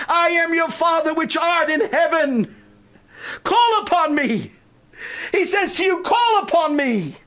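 high and loud, shouted promises with rising fervor; full-force preaching. Acted. Pastor voicing God's promises to the church, shouting them out.

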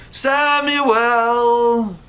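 high, sustained sing-out of the name; calling out. Acted. Voice calls the boy back in bed, long high call. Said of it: "a little while later he hears".

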